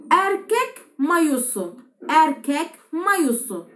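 slow word drill. Shown as a demonstration. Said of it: "teacher drills Turkish words slowly".

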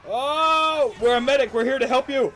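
ordinary talk, nothing marked

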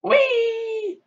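long high squeal of delight; sky-high gleeful whee; excited whee. Real, not acted. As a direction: ride the zip line with a joyful squeal. Zipping down the wire.

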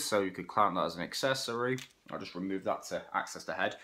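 usual speaking voice

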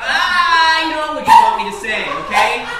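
loud, worked-up protest; exasperated outburst. Real, not acted. Husband accusing wife of wanting his answer, exasperated shout. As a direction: burst out under the pressure. Husband bursts out under pressure.

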